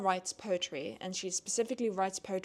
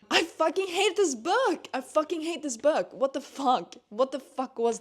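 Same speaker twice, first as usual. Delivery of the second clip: real anger, much higher and louder; furious outburst; 7 semitones higher, 5 dB louder than usual. Real, not acted. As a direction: let the hate burst out high and loud. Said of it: "I'm so angry".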